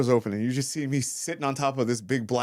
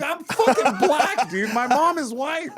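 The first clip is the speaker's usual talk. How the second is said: high, drawn-out, loud indignation; retold exasperated outburst; 13 semitones higher, 4 dB louder, slower than usual. Correcting an Uber driver about his identity, high exasperated outburst. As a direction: insist on it in comic outrage.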